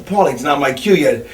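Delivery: low and soft, unhurried; cool, calm reply. Retold or imitated. The doctor calmly refusing Paulie, low and soft. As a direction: stay ice cool under pressure.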